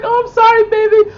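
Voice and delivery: high-pitched mock pleading; imitating apologetic girlfriend. Acting out the Russian caught by her boyfriend, high mock apology. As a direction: play a pleading woman in a high put-on voice. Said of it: "And she's like".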